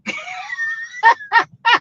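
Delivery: big shrieking burst of laughter. Real, not acted. Host cracks up at comment.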